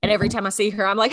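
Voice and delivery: ordinary delivery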